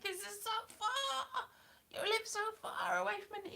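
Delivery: exaggerated high-pitched parental moan; mock complaining parents. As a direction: whine it high, stretching the vowels.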